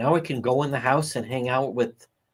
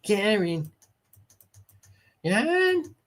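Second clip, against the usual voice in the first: higher mock Brockton call of "Karen", rising into a question; Brockton accent calling Karen; 9 semitones higher, slower than usual. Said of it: "total Brockton accent".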